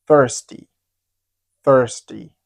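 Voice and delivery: "thirsty" with a clear TH at the start; careful TH pronunciation. Shown as a demonstration. Teacher modelling the good pronunciation, careful TH in "thirsty". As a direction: pronounce "thirsty" carefully, opening on TH. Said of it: "if you'd like to pronounce it well".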